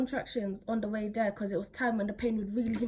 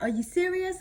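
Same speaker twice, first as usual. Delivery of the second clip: incredulous; 9 semitones higher than usual. Retold or imitated. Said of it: "I was just thinking".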